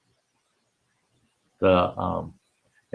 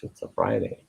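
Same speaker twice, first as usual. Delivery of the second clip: "it's a Friday" whispered much lower and softer; 11 semitones lower, 6 dB quieter than usual. Shown as a demonstration. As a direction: breathe "Friday" as a secret.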